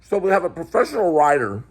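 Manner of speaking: talking with mouth full; words through a mouthful of food. Real, not acted. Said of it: "I can't actually hear with your mouth full".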